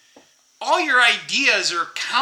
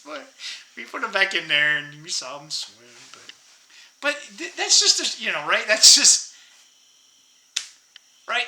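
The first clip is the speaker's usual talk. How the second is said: ordinary talk, nothing marked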